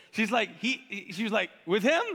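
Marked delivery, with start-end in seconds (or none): 1.6-2.1 loud incredulous question into a high mock laugh; scoff, then burst into hammed-up laughter; acted derisive cackle of disbelief; sarcastic disbelief. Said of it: "this is very sarcastic".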